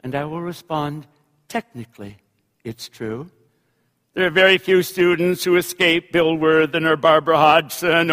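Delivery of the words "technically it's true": low, hushed, guarded reply; quiet admission. Acted. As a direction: concede it softly and low. Quiet, reluctant reply in anecdote.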